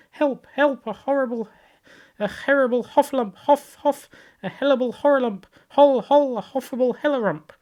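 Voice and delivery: high, loud, garbled terrified squeals; shriek, tripping over the word in fright; panicked crying out. A narrator's reading. Piglet scampering off, crying out in high panic. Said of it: "still crying out".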